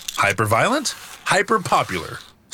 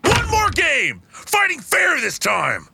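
shouting; 12 semitones higher, slower than usual. Real, not acted. Demanding a rematch.

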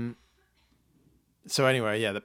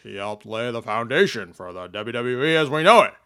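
Vince McMahon impression; 9 dB louder than usual. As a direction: boom it out like a wrestling boss. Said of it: "Vince McMahon tweeted".

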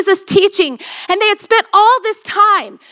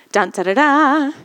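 low sung ominous sting; ominous little musical cue; dramatic dun da da; 4 semitones lower than usual. Pretend. Dramatic sound for the storm.

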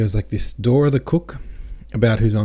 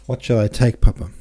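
distracted; 2 semitones higher, 5 dB louder than usual. A narrator's reading. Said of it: "she asked distractedly".

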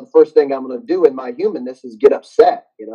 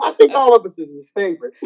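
laughing; 5 semitones higher, 3 dB louder than usual. Real, not acted. Guest laughing after host's joke.

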